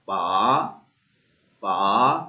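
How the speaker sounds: पा said low and rising up; second tone pa. Shown as a demonstration. Teacher showing the rising tone on pa. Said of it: "second tone".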